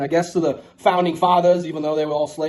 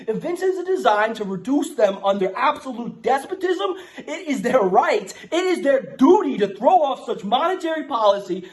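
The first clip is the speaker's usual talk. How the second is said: grand, measured proclamation read aloud; formal declaration reading; 6 semitones higher than usual. Acted. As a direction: read it like a solemn founding document, a touch mock-heroic. Reads a mock founding declaration.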